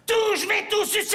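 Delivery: high shrieking vampire cry; vampire shout. Acted. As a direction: scream it like a crazed vampire. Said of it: "un vampire".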